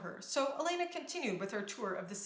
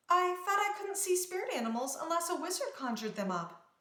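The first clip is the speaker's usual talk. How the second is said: surprised; 8 semitones higher than usual. A narrator's reading. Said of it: "Elena was surprised".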